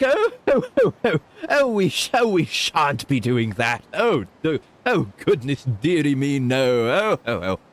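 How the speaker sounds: high, loud fluttering fop with shocked ohs; a scandalised dandy refusing, hand to chest; shrill-free high-pitched foppish gentleman, slow on shan't. Pretend. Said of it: "what's the other voice".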